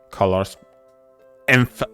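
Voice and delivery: ordinary talk, nothing marked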